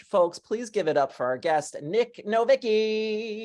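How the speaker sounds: big rising build, name stretched out high; announcer's guest introduction. Real, not acted. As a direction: hype up the guest, drawing out the name. Host announces guest to applause.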